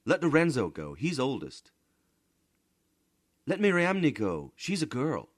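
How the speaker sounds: put-on high, loud children's bickering; children arguing. A narrator's reading. Children arguing over who should fly to Mars, high and loud. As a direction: squabble over who goes.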